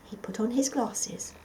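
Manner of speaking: plain reading voice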